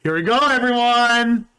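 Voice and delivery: loud, high hype call; rally the chat; excited shout. Real, not acted. Hyping chat for big scene.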